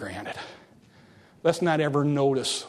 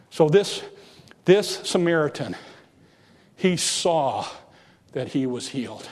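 normal talking voice

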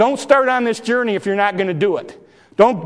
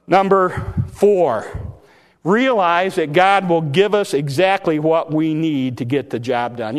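ordinary delivery

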